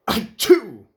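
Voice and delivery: big exaggerated achoo; loud sneeze. A narrator's reading. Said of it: "he sneezed".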